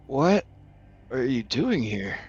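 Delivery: hesitant, strained question through a speaker; crackly speaker voice. Acted. Grom in the tube, surprised and scared, crackly speaker voice. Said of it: "it sort of crackles".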